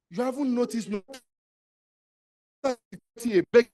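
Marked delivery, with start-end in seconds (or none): none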